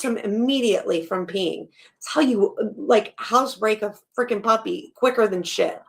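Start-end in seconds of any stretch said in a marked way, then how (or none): none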